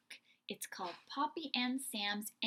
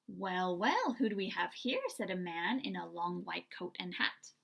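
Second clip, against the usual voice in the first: lively storybook read-aloud with voiced characters; animated children's story narration; 4 dB louder than usual. A narrator's reading. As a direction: read it like bedtime story time for little ones. Reads picture book aloud.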